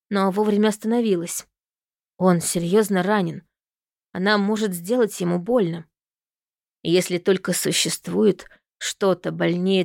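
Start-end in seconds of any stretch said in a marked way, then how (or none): none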